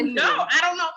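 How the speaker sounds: playful laughing brush-off; laughing denial. Retold or imitated. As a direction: laugh through the answer. Quoting herself, laughing it off.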